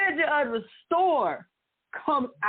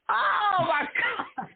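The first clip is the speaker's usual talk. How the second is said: laughing disbelief; 9 semitones higher, much slower than usual. Real, not acted. Reliving mom's betrayal, laughing.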